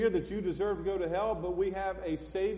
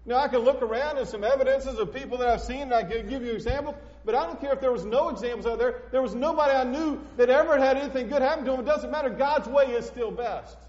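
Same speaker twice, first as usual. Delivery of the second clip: loud, higher fervent preaching; 7 semitones higher, 6 dB louder than usual. Real, not acted. Preacher insisting to his congregation, loud and fervent. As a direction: drive it home to the congregation.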